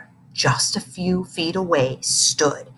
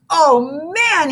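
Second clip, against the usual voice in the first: high, gleeful hoot; boy gloating to his friend; 9 semitones higher, 4 dB louder than usual. A narrator's reading. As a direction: crow with delight over the joke. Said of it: "he hooted".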